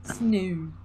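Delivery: "Snoo" answered back in a teasing Australian twang; mock Australian accent. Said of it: "So the Australian accent is back".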